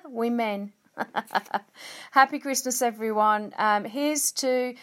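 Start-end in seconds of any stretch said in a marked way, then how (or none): 0.0-2.1 laughing through the word. Real, not acted. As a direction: giggle as you repeat it. Retakes word while laughing.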